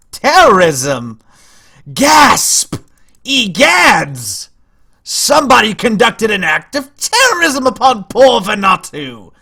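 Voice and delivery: loud, high mock outrage; hammed-up shock. Streamer announcing terrorism upon poor Vanuatu, hammed-up alarm. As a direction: proclaim it in theatrical, exaggerated horror.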